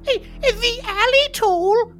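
very high, slow quavering granny; old lady voice. Acted. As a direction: ask like a baffled little old lady. Old lady confused by mugger.

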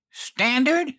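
high, shrieking echo of his word; a shrill furious repeat; shrieked. A narrator's reading. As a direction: fling the word back at him in disbelief. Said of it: "she shrieked".

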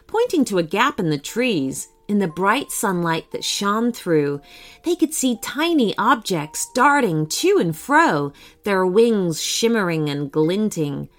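ordinary delivery

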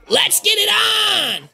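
very high, strained yell; rallying shout. Acted. Game master as fight host, high strained shout.

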